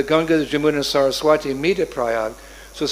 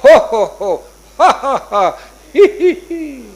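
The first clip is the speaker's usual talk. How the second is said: loud laugh climbing higher each round; big hearty laugh; 8 semitones higher, 8 dB louder, slower than usual. Acted. Regal man laughing while rolling in the dust, hearty laugh. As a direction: roar with laughter, then giggle up high.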